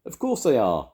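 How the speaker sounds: high, loud granny reply; assured Grandma. A narrator's reading. Grandma mishearing the butcher, loud assured reply.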